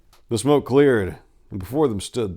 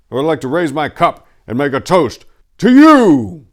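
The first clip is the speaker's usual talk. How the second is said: grand toast; 6 semitones higher, 11 dB louder than usual. Acted. Falcon's toast to his lords.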